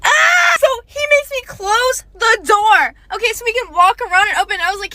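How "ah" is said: a sudden high yelp of disbelief; shocked yelp. Real, not acted. Telling her audience about him, a shocked high yelp. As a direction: yelp out, stunned he'd say that. Shrieks at being bossed around.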